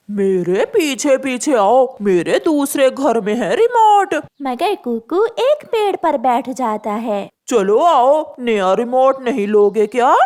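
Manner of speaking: sly crow. Acted. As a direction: lure him onward. Crow coaxing louder.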